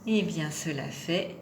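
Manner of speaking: ordinary talk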